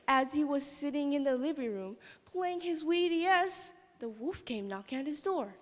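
ordinary delivery

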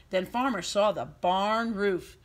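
plain reading voice

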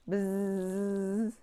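buzzing like a bee. Pretend. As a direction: buzz it out like a bee.

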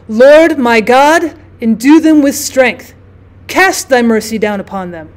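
slow, loud, solemn invocation; a priest intoning a slow, loud blessing; solemn prayer. A narrator's reading. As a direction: call on God slowly over the deathbed. Priest prays over dying woman.

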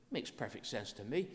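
usual speaking voice

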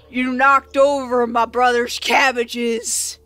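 slow, menacing big-brute voice; snarling Goliath. Acted. Goliath holding up the one who wrecked the cabbages, snarling. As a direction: snarl it slowly like a huge brute. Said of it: "he just snarls at you".

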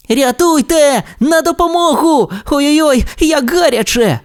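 very high, mock-panicked cries for help; hammed-up panic from a burning window; yelling for help. Trapped race car cries for help, hammed-up panic. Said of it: "галасував Блискавка Маквін".

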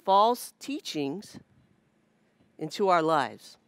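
steady, measured sermon delivery; preaching. Acted. Preacher lays out the teaching to the church, earnest and measured.

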